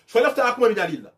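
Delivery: ordinary delivery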